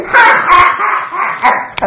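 very high jeering laugh; mocking laughter. Gang mocking the prophet, high jeering cackle. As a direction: cackle in scorn.

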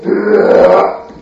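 low growl of a full belly; contented growl. Acted. Full man grumbles after eating.

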